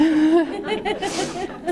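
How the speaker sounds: a bright high laugh. Real, not acted. Telling the audience about her daughter, high laugh.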